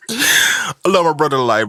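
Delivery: high, laughing fondness. Real, not acted. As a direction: push the words out through a laugh. Cracks up telling the story.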